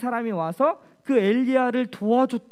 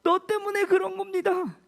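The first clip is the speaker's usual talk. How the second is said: blaming; 10 semitones higher than usual. Acted. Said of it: "엘리야 탓을 하는 거예요".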